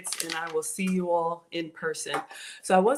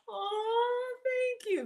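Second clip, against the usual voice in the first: high, soft, moved thank you; real touched whimper of thanks; 4 dB quieter, much slower than usual. Real, not acted. Streamer thanks Veggie's Thinking, high and touched.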